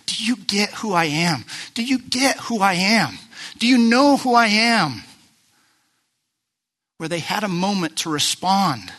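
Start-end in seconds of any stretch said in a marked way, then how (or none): 0.1-5.0 building from mild to strong, louder and higher; Jesus pressing the question. Acted. Jesus presses his disciples, rising to an emphatic call. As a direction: ask it again and again, pressing harder each time. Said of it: "officially looked and said".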